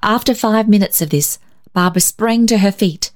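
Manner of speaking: plain reading voice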